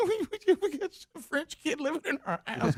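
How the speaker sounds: high, squeaky laughing imitation; words squeezed out through giggles; laughing to tears. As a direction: imitate someone helpless with laughter. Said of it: "we just get to laughing and tears".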